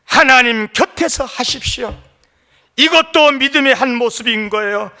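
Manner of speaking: high, loud, impassioned pulpit delivery; full-force preaching. Acted. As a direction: preach it forcefully at the top of your range.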